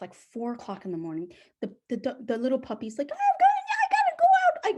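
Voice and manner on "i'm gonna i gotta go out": very high, loud, fast puppy squeal; frantic puppy. Retold or imitated. Puppy waking owner at four a.m., frantic. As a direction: yelp it urgently in a tiny puppy voice. Puppy urgently needing out, voiced.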